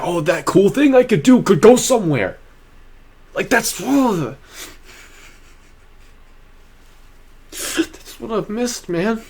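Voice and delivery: choked-up, high and slow; getting emotional. Real, not acted. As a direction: voice rising high and breaking with feeling. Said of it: "I'm getting emotional".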